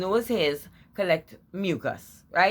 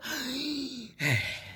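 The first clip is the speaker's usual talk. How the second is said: mock gasp for air; 9 semitones higher, 5 dB quieter than usual. Mimes gasping for breath.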